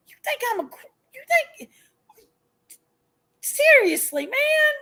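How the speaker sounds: usual speaking voice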